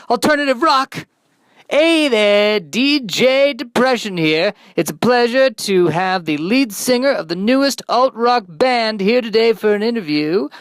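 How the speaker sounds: high, loud, bright-voiced radio host. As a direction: pump up the show like a cheesy DJ.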